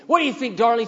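high, loud challenge; imitating a taunting coworker; mock taunting. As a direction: needle him loudly in front of the guys. Coworker taunts him before the crowd.

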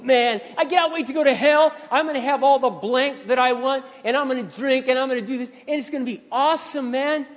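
high, loud, boastful rant; imitating a loud, cocky taunter; mouthing off. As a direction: go off like a rowdy coworker bragging to the crowd. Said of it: "he just goes off".

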